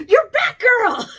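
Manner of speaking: high booming announcement; coach's delighted shout. Retold or imitated. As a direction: proclaim it like a coach. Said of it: "he looks at me and goes".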